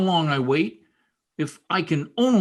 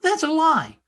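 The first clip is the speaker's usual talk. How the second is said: higher, rising in outrage; indignant denial; 10 semitones higher than usual. Acted. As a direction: protest the lie, voice climbing. Boy accused by jealous brother.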